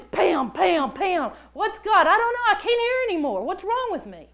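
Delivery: high, loud, agitated self-questioning; acted inner panic. Woman torn by voices in her head, loud and frantic. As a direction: spiral into frantic doubt, pitched high.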